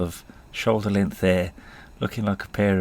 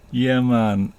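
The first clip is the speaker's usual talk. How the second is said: put-on drawn-out Jamaican accent, louder; 4 semitones higher, 5 dB louder than usual. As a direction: stretch it out in a bad Jamaican accent. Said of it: "the worst Jamaican accent you're ever gonna hear".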